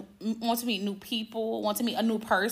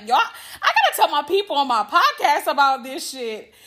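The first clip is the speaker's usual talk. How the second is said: excited; 4 semitones higher, 6 dB louder than usual. Retold or imitated. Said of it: "I was like".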